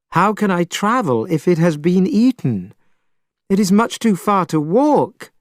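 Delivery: high plaintive wail, priest voice; crying out. A narrator's reading. Young priest wailing to Monkey about the long walk. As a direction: whine at the hopeless distance. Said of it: "cried Tripitaka".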